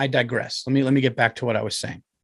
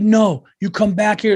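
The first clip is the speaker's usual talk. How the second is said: retold shout; 8 semitones higher, 6 dB louder than usual. Retold shouting match at door.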